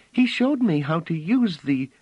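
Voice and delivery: put-on high, loud proud child's voice; proudly. Phelim proudly telling Bliss about the instrument. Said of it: "Phelim said proudly".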